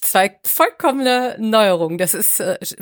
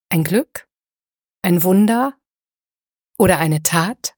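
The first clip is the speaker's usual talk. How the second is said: poem reading. Acted. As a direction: let each question hang in silence. Reads poem as episode opener. (langsamer, getragener Gedichtvortrag)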